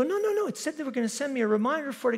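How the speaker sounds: high, fast flustered excuse. Retold or imitated. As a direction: rush it out like a caught kid. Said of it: "I go".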